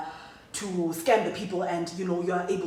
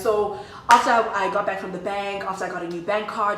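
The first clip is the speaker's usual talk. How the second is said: normal talking voice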